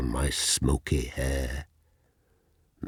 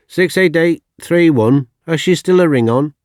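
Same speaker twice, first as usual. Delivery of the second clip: brisk official voice, much higher and louder; clerk on phone; 13 semitones higher, 11 dB louder than usual. Retold or imitated. Crematorium clerk phoning down about the ring. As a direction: rattle it off like a busy clerk.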